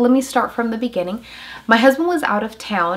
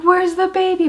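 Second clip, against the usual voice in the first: higher, urgent question; retold frantic question; 10 semitones higher, 4 dB louder than usual. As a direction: ask desperately for the baby.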